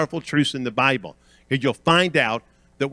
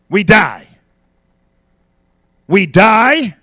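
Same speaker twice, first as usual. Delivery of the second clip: loud pulpit refrain, punched endings; emphatic, rhythmic preacher's cadence; forceful preaching; 5 semitones higher, 9 dB louder than usual. Real, not acted. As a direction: hammer each refrain home with pauses.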